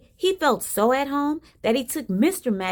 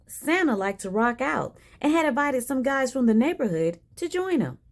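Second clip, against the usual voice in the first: plain reading voice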